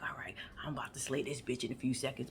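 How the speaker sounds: hushed scheming whisper. Retold or imitated. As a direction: whisper it under your breath. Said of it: "and you be like".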